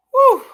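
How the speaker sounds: high, loud exhaled whoop; real exasperated whoop; calming-down whoo. Real, not acted. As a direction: vent the rising anger in a short "woo". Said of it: "[name]'s gotta calm down".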